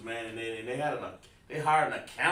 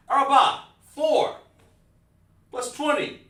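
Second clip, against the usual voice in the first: 1 loud calling out; 12 semitones higher, 10 dB louder than usual. Shown as a demonstration. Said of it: "teacher calls out numbers loudly".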